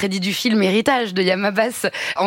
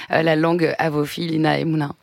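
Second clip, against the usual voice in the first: normal talking voice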